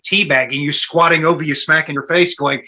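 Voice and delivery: ordinary talk, nothing marked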